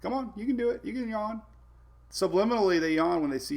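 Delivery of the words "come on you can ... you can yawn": higher, soft pet-talk coaxing; coaxing the dog. Real, not acted. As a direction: egg the puppy on gently, sing-song.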